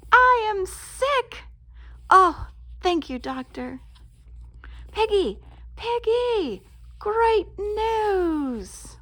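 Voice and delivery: thrilled to tell Piggy the news; delighted. Acted. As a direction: shout the good news with joy. Delighted at being sick.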